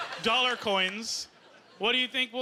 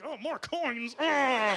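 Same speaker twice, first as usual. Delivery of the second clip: annoyed Canadian; 3 semitones higher, much slower than usual. Retold or imitated. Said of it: "gets Canadians pissed off".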